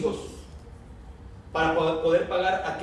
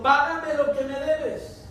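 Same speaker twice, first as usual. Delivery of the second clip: higher, slow, hard demand for the money; angry demand; 6 semitones higher, slower than usual. Acted. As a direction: squeeze the debt out of him. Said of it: "le ahogaba, diciendo".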